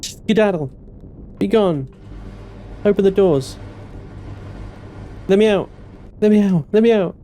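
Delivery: desperate panic. Real, not acted. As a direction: beg to be let out in terror. Trapped, begging to escape.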